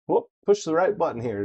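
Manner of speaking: ordinary talk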